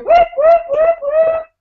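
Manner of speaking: high, loud party whoops; squealing whoop whoop of joy; celebratory whoop. Shown as a demonstration. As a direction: cheer like you just won. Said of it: "That's my new favorite thing to do".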